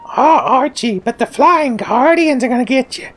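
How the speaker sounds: very high sitcom wife voice; Edith Bunker impression. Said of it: "my beloved Archie and Edith Bunker impressions".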